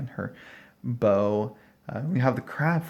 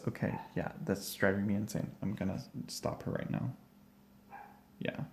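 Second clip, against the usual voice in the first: exasperated whisper under the breath; annoyed whispered mutter; whispered aside; 3 semitones lower, 10 dB quieter than usual. Real, not acted. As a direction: whisper it to yourself, fed up with the noise. Irritated by barking dog.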